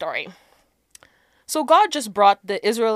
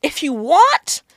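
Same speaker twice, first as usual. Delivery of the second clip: higher-pitched, drawn-out threat; mock warning; 8 semitones higher, 4 dB louder than usual. God warning people who want it the hard way, mock warning. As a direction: dangle the threat, pitch climbing.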